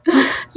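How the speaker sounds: short burst of laughter at a teammate's fail; a quick high laugh. Real, not acted. Gamer laughing at his friend's death.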